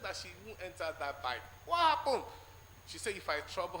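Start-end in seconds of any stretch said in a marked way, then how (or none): 1.6-2.2 loud, high "What happened?"; surprised outburst. Retold or imitated. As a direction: throw it out loud and high in disbelief. Wife refuses the bike.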